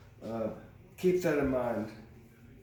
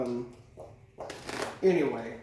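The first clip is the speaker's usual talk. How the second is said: usual speaking voice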